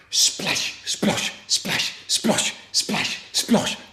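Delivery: whispery, rhythmic splashes; soft, hissed water sound effect; splashing noise. Acted. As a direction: make each word a splash in water. Imitates wading through the river.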